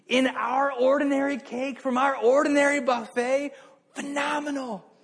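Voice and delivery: high, loud amazed gushing; gushing praise. Retold or imitated. Restaurant crowd raving over the baker, high excited gushing. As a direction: rave about the baker like a starstruck crowd. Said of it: "everybody's like".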